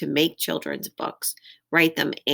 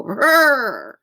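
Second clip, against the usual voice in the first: loud, high rolled whinny; horse-like zebra noise; 7 semitones higher, 8 dB louder than usual. Shown as a demonstration. Reader showing children a zebra sound, whinnying. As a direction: call out like a horse. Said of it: "sound a little bit like horses".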